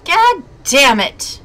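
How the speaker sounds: frustrated outburst; angry curse. Real, not acted. Escape thwarted.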